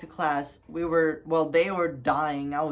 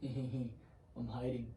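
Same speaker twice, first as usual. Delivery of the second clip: very soft, slow, lower voice; acted-out hiding; 5 semitones lower, 14 dB quieter, much slower than usual. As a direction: imitate a stifled giggle from a hiding place. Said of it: "this was literally me".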